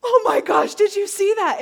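reliving the moment the leg grew, stunned and loud; astonished. Retold or imitated. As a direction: shriek in shocked amazement. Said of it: "And we both go".

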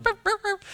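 very high-pitched small-dog barks; squeaky imitation yaps; little dog yapping. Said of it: "dog comes running in".

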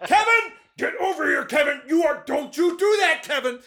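high, loud yell with a laugh; yelling at the dog. Retold or imitated. Owner yelling at the dog in front of kids, laughing shout. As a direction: shout him down, laughing in despair. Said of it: "out there yelling at the dog".

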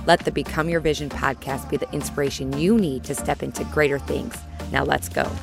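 upbeat announcer read over music; podcast intro read. Acted. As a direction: read it like a polished show intro.

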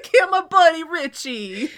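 put-on New Yorker accent. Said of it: "so much more New Yorker".

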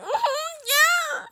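very high, squeaky sobbed yes; hammed-up tearful squeak through sobs; mock bawling. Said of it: "bawling my eyes out".

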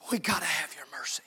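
sudden hushed whisper of a plea; whispered prayer. Acted. Pastor shows the congregation how to pray, whispering. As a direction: drop to a whisper, pleading for mercy.

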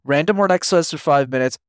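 normal talking voice

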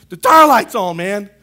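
hammed-up father bellowing a command; the girlfriend's dad shouting at the tire light; mock panicked dad shout. Dad again.

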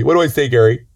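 ordinary talk

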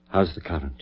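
ordinary delivery

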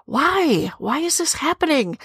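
ordinary talk

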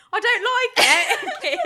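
British mock. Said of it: "we all went".

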